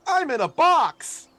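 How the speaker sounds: very high, loud, slow happy cry; excited squeal. Acted. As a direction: shriek it with pure glee. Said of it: "Key's gonna respond".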